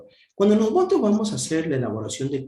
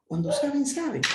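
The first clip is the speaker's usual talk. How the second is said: mock exasperation; 11 semitones higher, faster than usual. Teacher mock-laments that students know.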